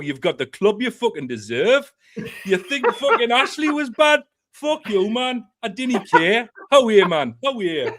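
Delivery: mock Geordie ranting at a fan; a hint of Geordie, jokey; Geordie accent. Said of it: "turn into a fucking Geordie".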